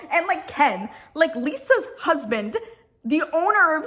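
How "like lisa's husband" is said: normal talking voice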